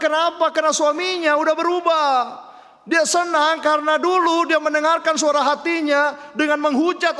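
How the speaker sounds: high, loud, driving sermon climax; impassioned preaching. Real, not acted. Preacher urging congregation to follow God, impassioned preaching. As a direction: preach at full force, building into fervent prayer.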